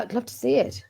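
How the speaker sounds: ordinary talk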